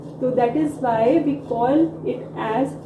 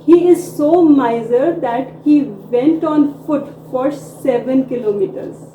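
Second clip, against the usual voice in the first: slow model sentence; 5 dB louder, much slower than usual. Shown as a demonstration. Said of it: "जैसे वह इतना कंजूस है".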